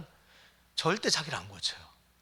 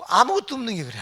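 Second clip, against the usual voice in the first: loud and higher, hammering 아무것도; emphatic outburst; 9 semitones higher, 9 dB louder than usual. Acted. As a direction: cry out 아무것도 없는 like a rebuke. Preacher stressing nothing to boast. (아무것도를 높고 크게 외치듯)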